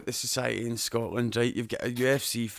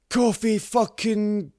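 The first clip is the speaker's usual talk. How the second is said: high, drawn-out, loud mock yell; drunk fan shouting; 10 semitones higher, 10 dB louder, much slower than usual. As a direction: bellow it slowly like a drunk heckler. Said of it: "this guy was so drunk".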